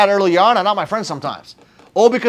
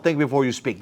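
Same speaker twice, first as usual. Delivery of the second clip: quiet advice; 6 semitones lower, 7 dB quieter than usual. Retold or imitated. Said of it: "I would have told myself".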